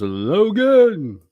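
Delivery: long, loud, high shout; drawn-out high-pitched name call; mock holler. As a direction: bellow the name, stretching it way out. Reads chat greeting as a holler.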